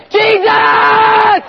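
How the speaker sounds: long, high holler; yelling out. Acted. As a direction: scream the name, stretched out. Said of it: "this guy's yelling out".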